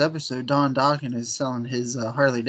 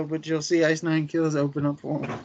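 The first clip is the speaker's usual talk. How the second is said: ordinary delivery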